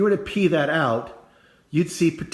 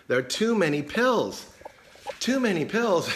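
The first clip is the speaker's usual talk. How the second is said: mock complaint; 6 semitones higher than usual. Acts out a patient's complaint.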